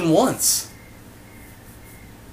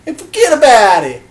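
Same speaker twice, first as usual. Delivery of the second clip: put-on Boston accent; 8 semitones higher, 9 dB louder than usual. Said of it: "give you a Boston accent".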